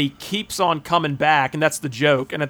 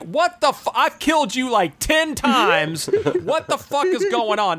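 high-pitched, exasperated retold outburst; mock disbelief; 8 semitones higher, slower than usual. Ford Fairlane to the unkillable assassin, high mock disbelief. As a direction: act out baffled protest, stretching the key words. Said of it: "He's like".

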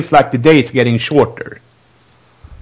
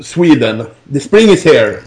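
ordinary delivery

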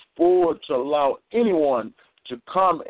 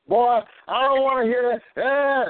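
high, slow, harsh scolding voice; stern father; 9 semitones higher than usual. Retold or imitated. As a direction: bark it like a fed-up father.

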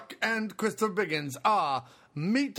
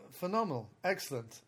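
ordinary talk, nothing marked

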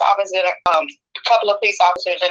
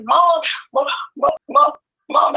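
higher, halting mock sobbing; imitating crying daughter; 6 semitones higher, slower than usual. Daughter calling her mom in tears, imitated crying. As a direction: stammer it out as a tearful child, acted. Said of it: "She was crying so hard".

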